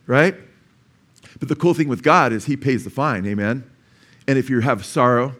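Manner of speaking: normal talking voice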